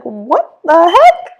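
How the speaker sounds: high, loud, drawn-out exclamation; baffled outburst. Retold or imitated. Retelling her shock to a friend, baffled outburst. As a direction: blurt it in exaggerated disbelief.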